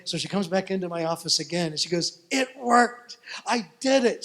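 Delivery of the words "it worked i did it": much higher and louder, triumphant; thrilled. Retold or imitated. Tina telling her pastor the bubble worked, thrilled. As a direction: burst out with the good news.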